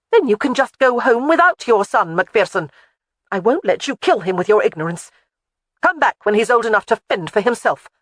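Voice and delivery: loud, high, furious ultimatum; furious refusal. A narrator's reading. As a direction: throw the ultimatum in his face. Jamie furious, refuses the child.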